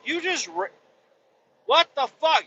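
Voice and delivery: high-pitched, broken-off retold outburst; incredulous sputter. Customer hears transmission ran out of fluid, incredulous.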